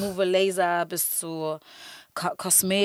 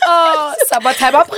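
mock dreamy; 8 semitones higher, 12 dB louder than usual. Mocking her own dreamy plan.

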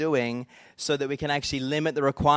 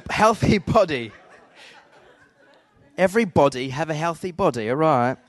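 loud, hammed-up British accent; silly fake UK accent; 2 semitones higher, 7 dB louder than usual. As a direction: ham up the British accent for laughs. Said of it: "enough of that silliness".

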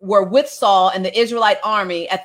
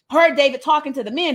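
ordinary talk

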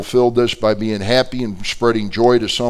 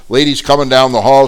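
usual speaking voice

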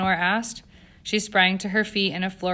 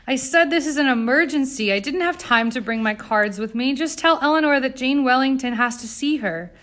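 raised, higher-pitched urgent demand; urgent insistence; 6 semitones higher, 6 dB louder than usual. A narrator's reading. Jane demanding that the butler fetch Eleanor, urgent insistence. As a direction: press the point forcefully, louder and higher.

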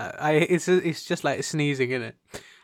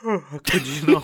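laughing; 7 dB louder, slower than usual. Real, not acted. Laughing after a yawn.

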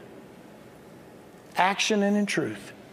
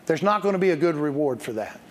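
ordinary talk, nothing marked

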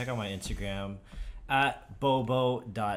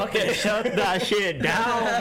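gym bro; 11 semitones higher, 7 dB louder than usual. Pretend. Hyped friend vows revenge on bullies.

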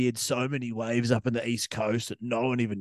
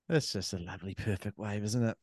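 hushed admiration; 4 semitones lower, 9 dB quieter than usual. Real, not acted. Admiring the wave, much quieter.

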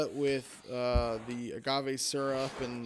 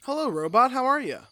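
playful high greeting; 9 semitones higher, 6 dB louder than usual. Pretend.

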